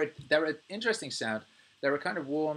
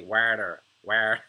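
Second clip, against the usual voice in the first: Southern drawl; 9 dB louder, much slower than usual. Retold or imitated. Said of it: "In the South, they say".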